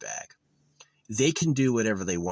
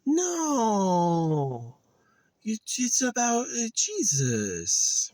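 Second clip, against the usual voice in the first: high, wondering gushing voice, slowing at the end; mock robot gushing; much slower than usual. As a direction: gush like a chatbot struck with awe. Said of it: "it's like".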